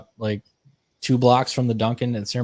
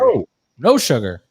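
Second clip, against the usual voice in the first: mock barista; 4 dB louder than usual. Mimicking barista.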